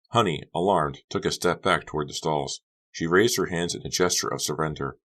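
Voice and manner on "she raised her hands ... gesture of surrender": plain reading voice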